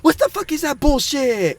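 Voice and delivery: high-pitched mock outrage; exasperated, high retold complaint; imitating mad gamer. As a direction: play a frustrated sore loser whining. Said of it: "he was pretty frustrated".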